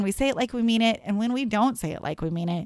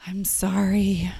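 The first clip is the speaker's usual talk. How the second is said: slow, drawn-out, whispery sorry; change your voice and act deeply sorry; exaggeratedly genuine apology; 2 semitones lower, slower than usual. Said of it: "you sound way more genuine".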